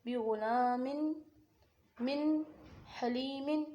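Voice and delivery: syllable-by-syllable recitation of बि गुलामिन; izhar demonstration. Shown as a demonstration. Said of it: "इस तरीके से अदा करना है".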